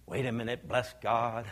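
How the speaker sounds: ordinary talk, nothing marked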